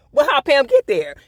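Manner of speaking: high, loud puzzled question; baffled outburst. Retold or imitated. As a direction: blurt it out in disbelief. Voicing her own puzzled reaction.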